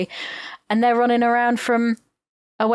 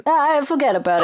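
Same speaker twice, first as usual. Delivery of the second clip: quick, higher New York wiseguy echo of "Ah, forget about it"; mobster accent; 5 semitones higher, 3 dB louder, faster than usual. Retold or imitated. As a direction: throw it back fast like a mobster. Echoing mobster catchphrase.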